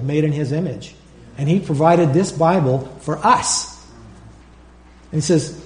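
ordinary delivery

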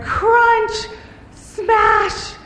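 crunch drawn out, smash shouted high; sound effects. A narrator's reading. Storyteller voicing the crash of the bike. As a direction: act out the crash noises. Narrating the truck crushing bike.

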